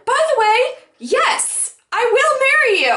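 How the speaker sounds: high-pitched, sudden happy realization; re-enacted thrilled acceptance; so excited. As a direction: blurt it out high and giddy. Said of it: "I was so excited about the fact".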